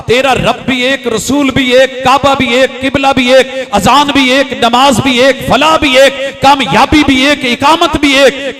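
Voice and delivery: loud, hammering litany, sustained high; full-force preaching. Real, not acted. Preacher urges congregation that everything is one, thunderous rhythmic preaching. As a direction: pound out each phrase, climbing to the last.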